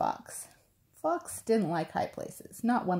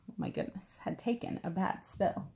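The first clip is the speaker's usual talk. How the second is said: ordinary delivery, nothing marked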